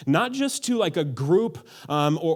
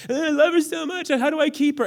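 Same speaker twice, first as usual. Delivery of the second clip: put-on much higher, pleading lovestruck young man; lovesick plea; 10 semitones higher than usual. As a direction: beg like a smitten guy asking for help, high voice. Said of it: "You turn romantic when you need to".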